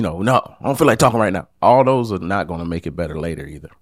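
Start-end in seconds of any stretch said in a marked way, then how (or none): 0.2-1.5 fast, louder, brushing them off; fast curt refusal. Shown as a demonstration. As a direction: snap it out quickly and dismissively. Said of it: "Not say".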